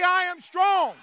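high, forceful declaration; with conviction. Shown as a demonstration. Preacher leading one side to repeat it, with conviction. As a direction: declare it like you believe it. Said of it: "Say it like you believe it".

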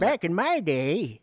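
put-on eighty-year-old. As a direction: drag it out like an elderly caricature. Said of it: "I sound like an eighty-year-old".

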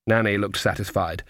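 normal talking voice

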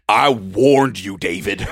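loud, slow warning; hammed-up scolding, slow and loud; mock angry warning. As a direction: draw out the threat loudly. Acted warning to heckler.

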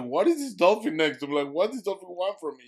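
high, loud, incredulous questions; bewildered. Retold or imitated. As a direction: demand it in total disbelief. Bewildered by following dolphin, retold.

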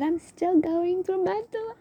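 high-pitched, whiny mock sob rising as it goes; climbing into a put-on tearful squeal; mock crying. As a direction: wail it like you're about to cry. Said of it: "I wanna cry right now".

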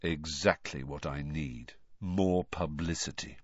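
low, soft, sarcastic gloom; dully resigned, under-voiced; dull. A narrator's reading. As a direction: grumble it wearily. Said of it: "said Harry dully".

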